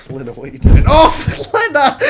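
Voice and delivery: high, loud laughing call; laughing yell. Real, not acted. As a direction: shout at her through laughter.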